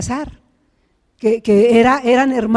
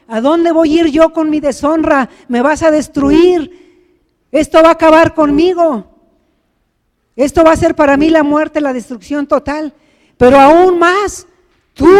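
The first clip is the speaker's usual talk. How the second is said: plea building to a louder, higher warning; impassioned pleading warning; 3 semitones higher, 5 dB louder than usual. Acted. Sister warning her brother of ruin, impassioned. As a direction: protest with mounting force.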